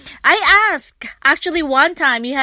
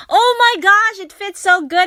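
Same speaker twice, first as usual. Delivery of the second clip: high and louder, thrilled gasp; delighted exclaim; 9 semitones higher, 6 dB louder than usual. Retold or imitated. Woman in store thrilled the sunglasses fit, high excited. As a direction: squeal at how well it fits.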